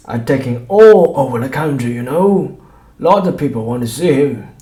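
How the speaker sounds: high, loud fairground barker; greasy shopkeeper bragging to the doctor; boastful showman. A narrator's reading. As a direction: sell it like a cheap showman.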